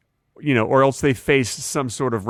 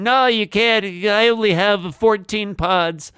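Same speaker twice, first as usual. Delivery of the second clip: put-on higher, slow, cranky old man; grumpy old man voice; 7 semitones higher, slower than usual. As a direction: play a stingy grumpy host. Mocking stingy host.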